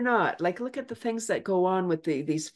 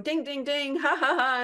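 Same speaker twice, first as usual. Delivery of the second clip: higher ding ding then a laugh; mimicked bell and laugh; 7 semitones higher than usual. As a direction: ring the bell with your voice, laughing. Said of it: "and I'm going".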